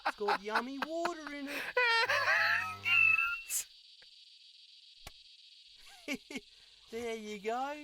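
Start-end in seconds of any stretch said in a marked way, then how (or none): 1.6-6.9 very high, loud cackling shriek; shrieking laugh. Acted. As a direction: let out a manic screeching laugh. Wild laugh after trade.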